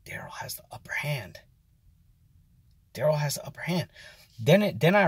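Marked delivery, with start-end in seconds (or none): 0.0-1.4 whispered in awe; awed whisper. Retold or imitated. Watching the cook win, whispered in awe. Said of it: "and I was like". 2.9-3.9 softer, hushed and awed; hushed repeat. Retold or imitated. Watching the cook win, a hushed awed repeat. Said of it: "and I was like".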